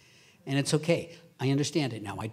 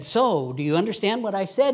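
eager loud question; 5 semitones higher, 8 dB louder than usual. Retold or imitated. Retold question to the priest.

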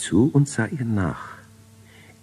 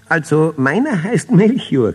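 giggling; 11 semitones higher, 7 dB louder than usual. A narrator's reading. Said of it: "kicherte Melchior".

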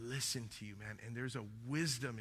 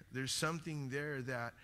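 ordinary talk

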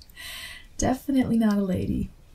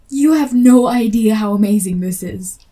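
gushing, much louder than usual; loud excitement; 9 dB louder than usual. Acted. Fan gushing to the author, loud and excited. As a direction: pour out the fan delight loudly. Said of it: "I'm being loud".

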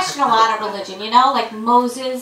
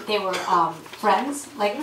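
ordinary talk, nothing marked

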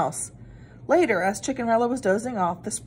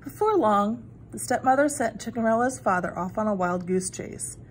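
ordinary delivery

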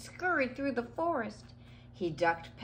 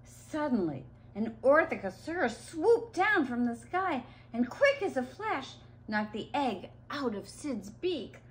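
usual speaking voice

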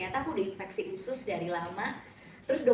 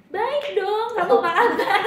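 high, loud put-on cheer; forced bright small-talk voice; fake cheerful answer; 9 semitones higher, 9 dB louder, slower than usual. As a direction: sell a fake cheerful reply. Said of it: "jawabnya fake ya".

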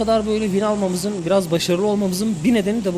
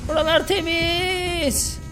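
singsong drawl; 9 semitones higher, slower than usual. Pretend. Teammate checks area, drawn-out singsong.

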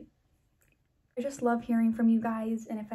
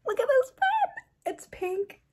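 high, loud, thrilled squeal over a gift; excited squeal; 14 semitones higher, 4 dB louder than usual. Real, not acted. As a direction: squeal with delight at the pen.

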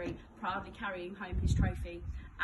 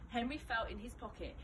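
usual speaking voice

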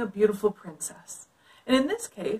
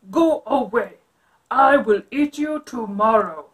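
loud, booming dragon voice; growling dragon; 9 dB louder than usual. Acted. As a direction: make the dragon big and gruff. Dragon answers Elizabeth's knock.

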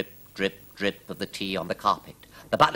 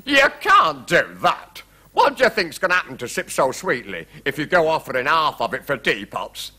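agitated king; 5 semitones higher, 5 dB louder than usual. A narrator's reading. King warning the queen, agitated and unregal. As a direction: protest in a flurry, not regal. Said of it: "forgetting to speak regally".

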